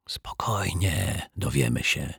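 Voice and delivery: soft, slow, panted whisper; out of breath. A narrator's reading. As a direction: puff out the reassurance very quietly. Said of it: "wysapała Zuzia". (zasapany, powolny szept)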